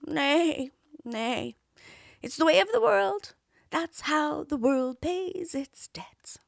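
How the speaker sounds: high, strained worn-out horse; old horse voice. Acted. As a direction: lame old nag lamenting, slow. Horse speaks in tale.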